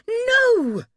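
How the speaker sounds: very high, loud shout. A narrator's reading. Bather refusing to let Duncan look, high panicked shout. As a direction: shout the refusal at full pitch. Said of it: "she shouted this time".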